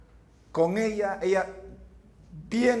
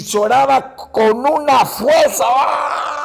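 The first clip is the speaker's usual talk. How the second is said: loud wail; 4 semitones higher, 8 dB louder, much slower than usual. Retold or imitated. Recalls sobbing at conversion.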